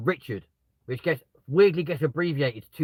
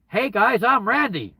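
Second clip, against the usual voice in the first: loud, high, brash hello to strangers; loud brash intro; 8 semitones higher, 7 dB louder, slower than usual. Shown as a demonstration. Greeting a group of strangers carelessly, loud and high. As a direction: blurt it out cheerily to a group. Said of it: "don't just walk up to them and go".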